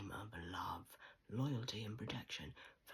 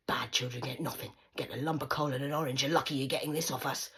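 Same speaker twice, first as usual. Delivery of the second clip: louder harsh lecture; mother telling her daughter bad children get nothing, louder; mum scolding; 2 semitones higher, 10 dB louder than usual. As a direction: threaten her with coal like a stern mother. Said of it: "what my mother said to me".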